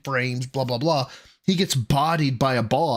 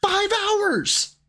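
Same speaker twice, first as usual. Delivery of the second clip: very high, outraged exclamation; incredulous high cry; indignant outburst. Real, not acted. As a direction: hurl it out at a high pitch. Repeats accusation to the clip.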